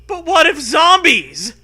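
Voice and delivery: high, loud, slow worried question; scared. Acted. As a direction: whimper it out loud. Acted scared quote.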